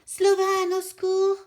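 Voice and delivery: high, very loud cry for help; shouting for help. Acted. Frightened kid calling the bear for help, shouting. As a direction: scream his name across the mountain. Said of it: "crie très fort".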